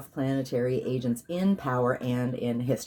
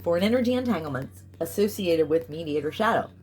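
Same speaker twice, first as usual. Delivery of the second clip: commanding ritual declaration; incantation decree; 4 semitones higher than usual. Real, not acted. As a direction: proclaim it like a spell.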